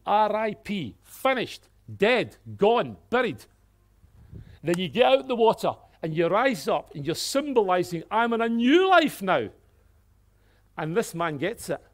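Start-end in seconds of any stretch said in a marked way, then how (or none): 0.1-3.5 louder, higher, each word hammered; loud, punchy list; emphatic declaration. Acted. Preacher acting the believer's declaration. 4.6-9.5 louder and higher, rising to new life; loud joyful declaration; triumphant proclamation. Acted.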